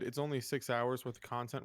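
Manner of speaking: normal talking voice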